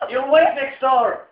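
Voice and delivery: drawn-out Irish dad telling him off; father warning about the neighbours, in an Irish accent. Retold or imitated. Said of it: "your parents' accents really as strong".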